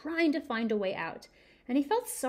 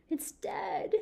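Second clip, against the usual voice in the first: dismayed cry; 9 semitones higher, 3 dB quieter than usual. Acted. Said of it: "he cried".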